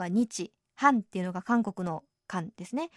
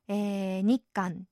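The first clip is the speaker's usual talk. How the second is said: ordinary talk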